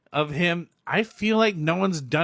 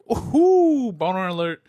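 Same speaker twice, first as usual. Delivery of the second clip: mock swooning; 4 semitones higher, 6 dB louder than usual. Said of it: "She's like".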